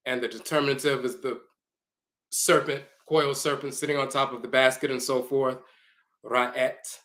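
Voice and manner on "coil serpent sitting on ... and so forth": ordinary delivery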